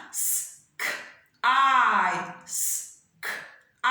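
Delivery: S, K, I said as separate sounds; sounded out. Shown as a demonstration. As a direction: break "S-k-I" into hiss, click and a long eye. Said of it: "The next word I have is sky".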